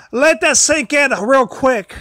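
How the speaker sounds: usual speaking voice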